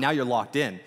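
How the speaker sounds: normal talking voice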